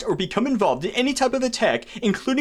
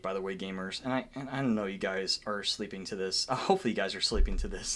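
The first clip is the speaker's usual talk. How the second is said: much lower, much softer, quick murmur; quiet aside; 12 semitones lower, 11 dB quieter than usual. Real, not acted. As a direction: murmur as if viewers are asleep. Muttered aside to viewers.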